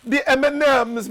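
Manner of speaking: high, loud mock-Jamaican lilt; hammed-up fake Jamaican; bad Jamaican accent. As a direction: attempt a Jamaican accent and miss. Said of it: "need to talk in a Jamaican accent".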